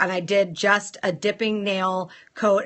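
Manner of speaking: usual speaking voice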